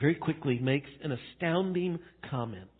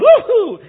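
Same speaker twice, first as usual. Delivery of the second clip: very high, loud mock "woohoo"; cartoon villain's squealed cheer; Satan's gleeful whoop; 16 dB louder than usual. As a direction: whoop in hammed-up devilish triumph. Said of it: "Satan going".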